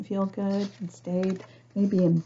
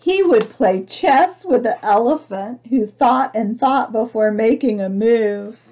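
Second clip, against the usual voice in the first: louder, lively read-aloud voice; storybook reading; 2 semitones higher, 8 dB louder than usual. A narrator's reading. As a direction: read it out to a whole class. Reading picture book aloud.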